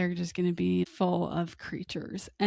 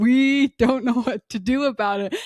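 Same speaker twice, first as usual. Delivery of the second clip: mock storage clerk; 5 semitones higher, 7 dB louder than usual. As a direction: play a clerk cheerfully admitting it. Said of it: "what are they gonna tell you".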